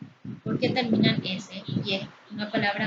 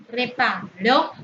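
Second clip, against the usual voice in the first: "Reparó" said slowly with the final -ró punched; slow, end-stressed "Reparó"; stress on final syllable; 5 dB louder, slower than usual. Shown as a demonstration. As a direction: draw out "Reparó", landing hard on the last syllable. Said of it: "lo correcto es decir que reparó".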